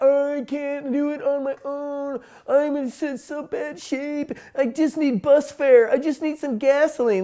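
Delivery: milk the sympathy for bus fare; pitiful beggar. Retold or imitated. Homeless person begging a stranger for money, whiny and pitiful. Beggar pleading with self-pity.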